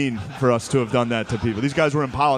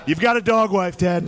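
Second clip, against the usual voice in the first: high, taunting debate impression; Donald Trump; 7 semitones higher, 4 dB louder than usual. Candidate taunting his rival about his family, high mocking impression. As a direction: needle him in a raised Trump voice. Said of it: "how to do his voice".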